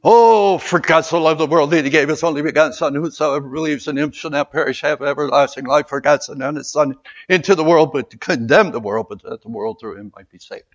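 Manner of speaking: rapid, loud mock recitation; hammed-up speed-reading of a memorized verse; rattling it off. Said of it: "You hear people rattling that off".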